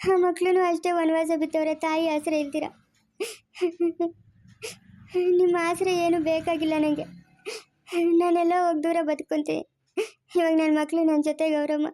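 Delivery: begging between sobs; crying. Acted. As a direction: weep and plead at her feet. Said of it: "ಸರಿ ಬಿಡು, ಅಳ್ಬೇಡ".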